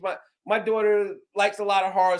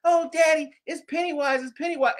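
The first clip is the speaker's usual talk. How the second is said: put-on higher, excited little girl calling out; excited daughter; 8 semitones higher than usual. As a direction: imitate a thrilled kid spotting Pennywise. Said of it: "she'll be like".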